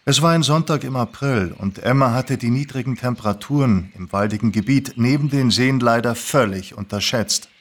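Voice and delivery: plain reading voice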